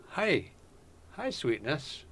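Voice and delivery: soft, tender greeting to a pet; whisper-soft hello to the cat; soft cooing. Real, not acted. Streamer greets his cat softly, tender.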